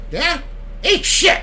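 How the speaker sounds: cheeky high parrot squawk. Retold or imitated. Parrot answers again.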